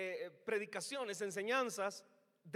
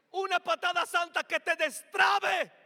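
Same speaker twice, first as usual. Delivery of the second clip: much louder, slow, higher shout; preaching shout; 6 semitones higher, 12 dB louder than usual. Real, not acted. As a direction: hammer it out to the back row. Preaching climax.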